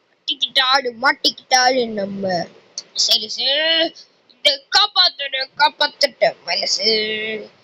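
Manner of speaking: usual speaking voice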